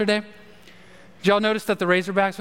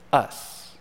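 whispered emphasis; 7 semitones lower, 3 dB quieter than usual. Real, not acted. Whispered single-word emphasis.

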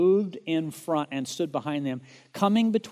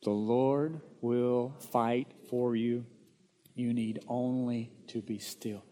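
slow reading along; 4 semitones lower, 3 dB quieter, slower than usual. Shown as a demonstration. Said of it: "Will you read that with me?".

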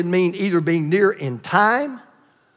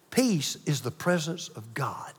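whispered; 6 semitones lower, 12 dB quieter than usual. Acted. Preacher drops to a whisper.

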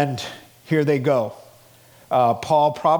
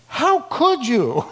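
slow, high-pitched playful reproach; mock outrage; 9 semitones higher, slower than usual. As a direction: act out a drawn-out, pretend-scandalised accusation. Mock outrage on phone call.

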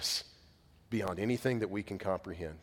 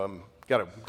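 ordinary delivery

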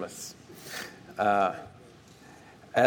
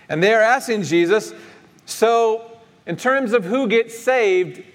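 high, raised preacher's voice; emphatic preaching; 10 semitones higher, 7 dB louder than usual. Real, not acted. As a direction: preach it to the congregation, pitched well up.

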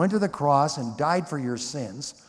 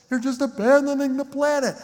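high, louder mock accusation; mocking critics; 11 semitones higher, 4 dB louder than usual. Preacher mimics critics calling believers escapists, mocking. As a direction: sneer out the critics' charge in a put-on voice.

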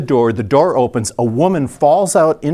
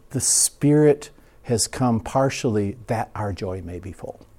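ordinary talk, nothing marked

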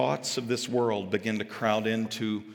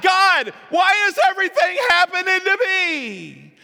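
high, hammed-up wail to heaven; crying out; 10 dB louder than usual. People demanding answers from God, crying out loudly. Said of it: "then we start crying out".

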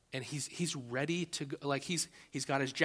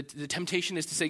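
normal talking voice

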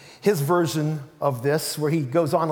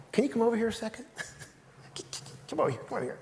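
soft, hushed, half-laughing call; hushed aside; 4 semitones higher, 10 dB quieter, much faster than usual. Retold or imitated. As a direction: Peter quietly calling Jesus aside. Peter pulling Jesus aside.

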